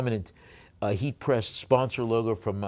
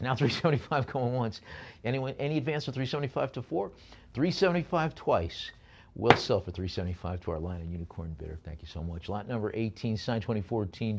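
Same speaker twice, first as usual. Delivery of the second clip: usual speaking voice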